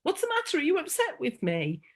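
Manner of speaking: a touch of an anxious daughter asking; lightly voiced worried child. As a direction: pitch jumps up on a light, worried little-girl question. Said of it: "she's like".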